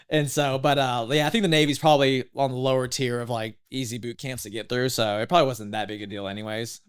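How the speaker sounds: normal talking voice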